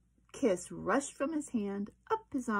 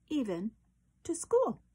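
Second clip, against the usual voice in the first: high, loud happy finish; triumphant; 6 semitones higher, 5 dB louder than usual. A narrator's reading. Reading the happy turn to the children, joyful lift. Climax of narration.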